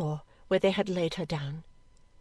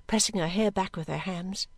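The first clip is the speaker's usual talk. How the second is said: usual speaking voice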